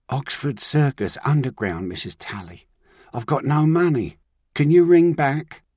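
put-on desperate runaway boy pleading for help. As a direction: beg her to ring back, slowing at the end. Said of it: "He sounded desperate".

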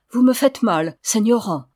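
ordinary delivery, nothing marked

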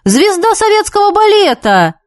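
high, excited shouted greeting; wild excited yell. A narrator's reading. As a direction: greet her with a big delighted cry. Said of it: "истошно завопил".

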